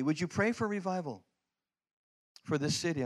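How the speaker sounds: usual speaking voice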